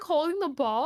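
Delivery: usual speaking voice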